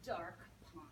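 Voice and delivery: plain reading voice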